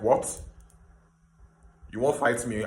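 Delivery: ordinary talk, nothing marked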